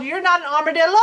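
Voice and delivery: crying wail of disappointment; a touch of teary dismay. A narrator's reading. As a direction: wail it like a heartbroken little critter. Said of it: "he wailed".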